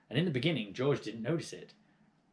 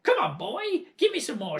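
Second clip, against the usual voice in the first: put-on high, loud old hag demanding; crone yell; 13 semitones higher, 6 dB louder than usual. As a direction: bark it down at the boy. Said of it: "she yelled".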